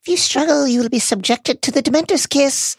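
very high, loud sugary villain voice; sickly sweet. Acted. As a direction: threaten in a syrupy, piping voice. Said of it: "sickly, sweet voice answers".

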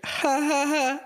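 high mock polite laugh; a polite sympathy laugh, pitched high; fake laugh. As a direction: imitate a forced, high little laugh. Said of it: "Was she just like".

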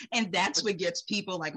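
normal talking voice

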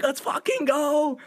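high, slow, drawn-out cheer; high drunk party cheer; mock hype yell. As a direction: pump up the crowd, stretched out. Drunk story, yelling quote.

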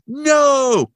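hammed-up gunman stopping people leaving; mock shout. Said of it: "And Muntz is like".

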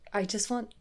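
soft, hesitant stab at Russian; tentative fake Russian accent; attempted Russian accent. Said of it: "oh, what's Russian?".

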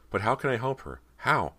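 high, pleading and urgent; desperate. Acted. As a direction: plead in despair. Desperate inner plea.

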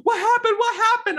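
high, loud worried cry; wife rushing in, panicked; frantic concerned question; alarmed wife. Retold or imitated. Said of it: "she's like".

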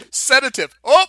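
loud, high-pitched mock yell; imitated yell. Host retells the doctor's cry for the sedative, yelled. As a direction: yell it out as the film character. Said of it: "And then he yells".

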